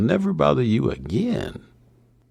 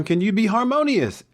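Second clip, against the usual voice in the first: ordinary talk, nothing marked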